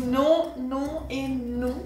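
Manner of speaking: slow emphatic refusal. Real, not acted. Drawn-out refusal.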